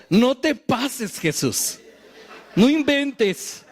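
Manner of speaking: slow, laughing no way; laughing disbelief. Real, not acted. Preacher joking about the apostles, slow laughing disbelief. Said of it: "reacting to Jesus' command".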